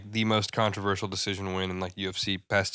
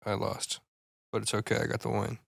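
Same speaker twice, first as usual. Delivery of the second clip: softer, understated imagined admission; conceding; 5 dB quieter than usual. Retold or imitated. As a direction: own up quietly, shrugging it off. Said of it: "He should have been like".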